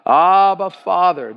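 lifted, ringing call; crying out. Shown as a demonstration. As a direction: cry it out for the congregation to join. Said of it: "Everybody cry it out".

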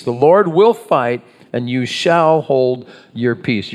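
"will" punched, then "shall" drawn out; stress on will and shall. Shown as a demonstration. Pastor contrasts the two promises, stressing "will" and "shall". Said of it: "I emphasized the word shall".

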